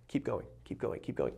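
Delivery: whispered encouragement from the heart; soft whispered mantra; whispered self-talk. Retold or imitated. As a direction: breathe it out as a whisper. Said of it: "moved it right here to my heart".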